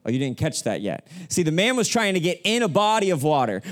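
normal talking voice

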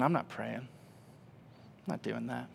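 very soft, slow, flat refusal; hushed refusal. Retold or imitated. Grieving husband refusing his wife's prayer, soft and slow. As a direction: barely say it, drained and stubborn.

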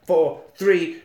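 usual speaking voice

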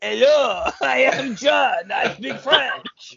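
slow, high cartoon French accent; mock French accent. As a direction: ham up a French tourist. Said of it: "you don't have to do that voice".

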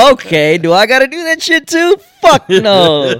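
high, loud, exaggerated disbelief; retold baffled reaction. Co-host reacts to host's voice change, comic disbelief. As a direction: pitch climbing, then a loud refusal. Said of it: "And I was like".

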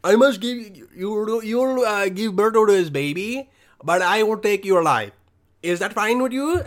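hammed-up Indian accent, booming God offering a deal; mock Indian God. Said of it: "I thought God is Indian".

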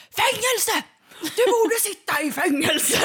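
very high, loud accusing yell; hammed-up balcony tirade; mock furious shouting. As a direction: stretch it out like an outraged neighbour. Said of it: "så började han i alla fall skrika".

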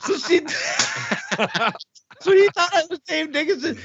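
can't hold the laugh in while telling it; real giggle breaking through the words; real laughter. Real, not acted. Cracking up at own story.

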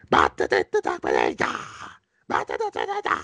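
mock-Italian gibberish in a menacing character voice; scary Al Capone Italian. Critic mimics the actor's scary Italian outbursts, menacing gibberish. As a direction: rattle off nonsense syllables like scary Italian. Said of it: "the scariest shit ever".

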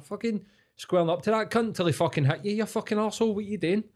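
high-pitched furious telling-off, replayed; retold angry rant. Speaker berating the drunk old man who picked the fight, high angry rant.

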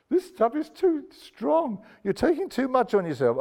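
high-pitched, acted objection; offended protest. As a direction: voice the scandalised crowd pushing back.

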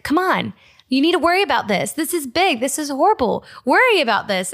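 worried pleading. Retold or imitated. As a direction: push the calm friend to take it seriously. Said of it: "you're worried about something".

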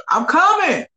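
high, loud call to another room; answering someone calling him. Real, not acted.

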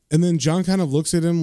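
ordinary delivery

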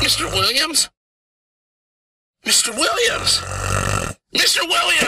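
calling out. Acted. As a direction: call him, getting urgent. Calling out to sleeping client.

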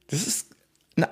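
usual speaking voice

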